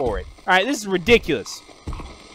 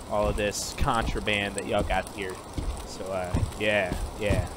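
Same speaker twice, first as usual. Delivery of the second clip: deep mock officer voice; 9 semitones lower, 5 dB quieter than usual. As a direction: drop into a low fake-authority voice to seize contraband. Role voice confiscating the guns.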